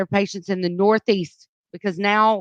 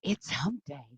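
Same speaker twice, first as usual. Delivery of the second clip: softer, lower throwaway echo; quiet hump day; 5 semitones lower, 13 dB quieter than usual. Acted. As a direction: toss it off under the breath. Repeats gag after the shout.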